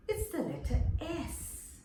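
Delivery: ordinary talk, nothing marked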